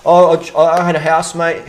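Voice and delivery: ordinary delivery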